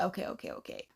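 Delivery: breathy whisper, resigned; just breathe the words out. Retold or imitated. Resigned reply to cousin's friend.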